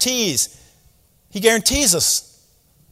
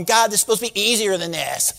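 mock whine, softer than usual; whining; 4 dB quieter than usual. Preacher imagining his own prayer to God, whining. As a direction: whine it like a put-upon complainer. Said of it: "I'd be whining, going".

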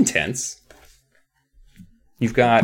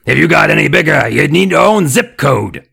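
fast, loud, higher puppet jeer; nasty dummy; 3 semitones higher, 6 dB louder than usual. A narrator's reading. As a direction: fire off the insult as the dummy. Dummy keeps insulting the teacher.

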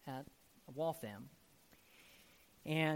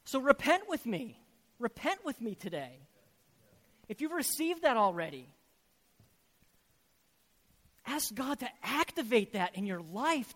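loud, higher, urgent preaching; full-force preaching; 10 semitones higher, 11 dB louder than usual. Acted. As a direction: drive it home to the congregation.

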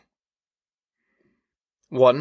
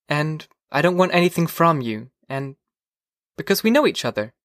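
put-on higher-pitched voice for the earnest girl; young girl character; 3 semitones higher than usual. A narrator's reading. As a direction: lift the pitch to play the girl. Girl's dialogue in narration.

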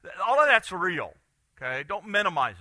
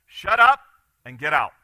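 curt raised-voice dismissal; sharp command; 8 dB louder than usual. Acted. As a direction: throw the demon out loudly. Acts out Jesus ordering demon out.

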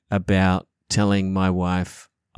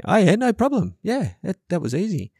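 much higher, cocky voice brushing it off; smug husband; 11 semitones higher than usual. Retold or imitated. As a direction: play the pleased-with-himself husband. Husband replies smugly to wife.

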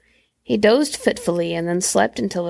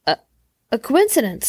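halting stammer, then higher pitch; 3 semitones higher than usual. A narrator's reading. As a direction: stumble on the first word, pause, retry. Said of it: "stammered the old man".

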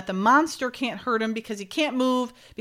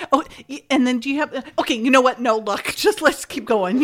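louder, higher, stumbling retold self-interruption; flustered backtracking; 4 semitones higher, 5 dB louder than usual. Imitating her dropping a question mid-way, flustered backtracking. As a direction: start the question, then hurriedly cut yourself off. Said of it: "she stops herself".

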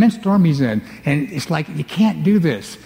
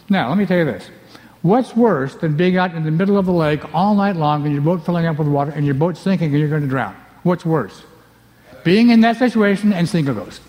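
ordinary delivery

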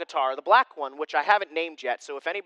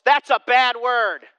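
put-on slow, louder little-kid reproach; mock child telling off his dad, louder; child scolding; 5 semitones higher, 8 dB louder, slower than usual. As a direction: imitate a small son correcting dad. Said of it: "Jax goes".

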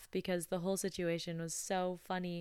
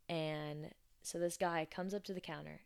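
normal talking voice

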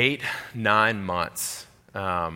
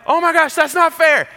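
put-on shrill, much higher and louder child's complaint; whiny kid; 10 dB louder than usual. Buddy's son complaining, much higher childish whine. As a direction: whine like a kid who lost out. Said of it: "stage where everything that happens".

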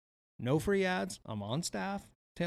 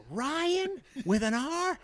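much higher, slow mimic of the woman; mock high neighbour voice; 14 semitones higher, slower than usual. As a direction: pitch way up to mock her. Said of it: "she's like".

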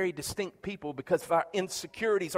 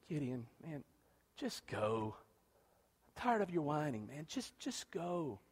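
weary God; 5 semitones lower, 12 dB quieter than usual. Retold or imitated. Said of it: "read it the way I interpret".

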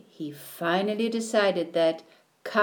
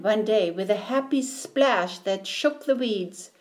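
plain reading voice

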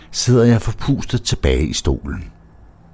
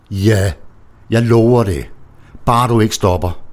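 eager, breathless-sounding promise, pleading; lustful pleading; 6 semitones higher, 3 dB louder than usual. A narrator's reading. Narrator promises Lise eagerly, pleading and lustful. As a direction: promise anything so she won't stop. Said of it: "siger jeg liderligt og tryglende".

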